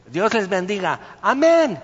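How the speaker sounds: exclaimed blessing, jumping high and loud on amén; mock hearty greeting. Newly arrived pastor greeting the congregation, mock hearty greeting. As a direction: call out a blessing, ending in a high burst.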